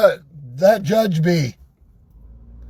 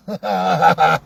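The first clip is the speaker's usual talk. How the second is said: short laugh. Real, not acted. Laugh after reveal.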